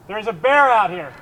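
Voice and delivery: loud, high warning; alarmed shout. Real, not acted. Warning of a bear.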